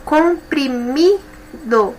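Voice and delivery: "Comprimido" very slow, "mi" stressed; slow stressed demo. Shown as a demonstration. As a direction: stretch "Comprimido", hitting "mi". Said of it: "também paroxítona". ("Comprimido" bem devagar, com força no "mi")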